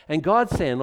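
ordinary talk

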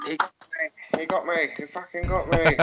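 high, hammy dying cry; mock wounded soldier. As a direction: play the fallen comrade gasping. Mock dying soldier after mishap.

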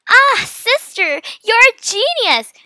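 high, slow, drawn-out shout; excited shout. A narrator's reading. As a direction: shout it in delight at your sister. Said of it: "Mia shouted".

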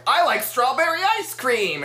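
high, mock little-kid exclamation; ghost kid. Retelling Seth's big line, high ghost-kid voice. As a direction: pipe it up like an eager cartoon child.

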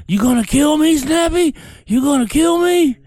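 pissed-off coach. Retold or imitated. Coach yelling at a hungover athlete, high angry shriek. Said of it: "Boy, he got pissed".

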